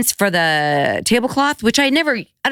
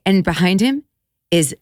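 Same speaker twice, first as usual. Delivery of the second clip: plain reading voice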